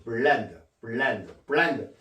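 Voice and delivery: 블렌드 with a full L, bul-lend; L joined from bul-land. Shown as a demonstration. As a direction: model "블렌드" with the tongue held on the L. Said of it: "불하고 랜드 이렇게 해야죠".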